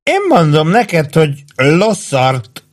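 mildly voiced drunken orator; orating drunkenly. A narrator's reading. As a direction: declaim it like a tipsy soldier holding forth. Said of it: "szónokolt Warden".

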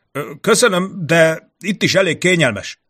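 high, wavering, nervous reply; trembling voice. A narrator's reading. Envoy declining the rope up the wall, trembling. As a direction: decline nervously, pitch jumping up. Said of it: "felelte a férfi remegő hangon".

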